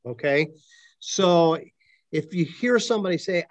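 usual speaking voice

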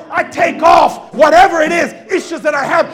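loud, rising preacher's exhortation; full-voiced Sunday preaching; preaching at full force. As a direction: drive it home to the congregation.

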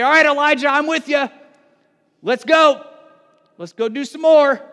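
like a general rallying his troops; rallying call. Pretend. Imagined God rallying Elijah.

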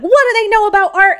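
high, loud scornful outburst; indignant scoff. Retold or imitated. Fuming artist scoffing at the committee, high and indignant. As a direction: toss it off as an offended artist. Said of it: "And I was like".

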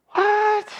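a sudden, high-pitched startled "What?"; mock shock. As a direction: jump way up in pitch in disbelief. Shocked reaction to the pregnancy.

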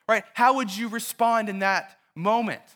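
ordinary talk

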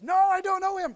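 high, loud desperate shout; panicked denial. Retold or imitated. Peter shouting at the servant that he doesn't know him. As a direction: yell the denial to get them off you.

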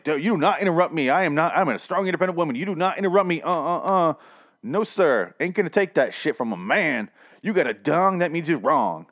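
mock a sassy strong woman telling off a man; mocking a strong independent woman in a roast debate.

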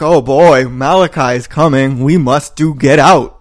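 loud, slow, hammed-up theater-camp delivery; over-the-top child actors. Mocking the campy children actors, hammed up and loud. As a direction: overact it like a camp kid on stage. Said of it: "how over the top the children actors were".

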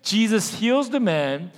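rising, emphatic sermon voice; preaching. Acted. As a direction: preach it with growing fire.

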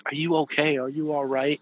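sons' worried voices. Retold or imitated.

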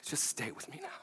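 whispered, very soft and tender; a tender whispered plea. Retold or imitated. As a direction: breathe it to her in a whisper. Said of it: "it comes out very tenderly".

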